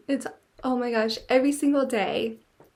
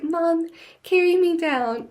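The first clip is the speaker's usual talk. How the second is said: higher, louder whiny little pig voice; 6 semitones higher, 6 dB louder than usual. Pretend. The pig asking mom to carry him downstairs, high whiny plea. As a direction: plead like a spoiled baby pig. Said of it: "he's like".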